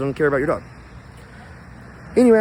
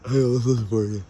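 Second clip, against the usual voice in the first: usual speaking voice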